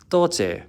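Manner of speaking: "toche" said high, falling in Cantonese tone. Shown as a demonstration. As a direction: start "toche" high and let it fall. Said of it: "the two expressions".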